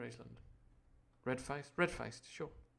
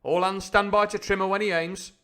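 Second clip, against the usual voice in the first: louder, higher commanding call; crying out; 7 semitones higher, 9 dB louder than usual. A narrator's reading. Captain shouting orders at the rowers, raised voice. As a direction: bark orders across the boat. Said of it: "cried the captain".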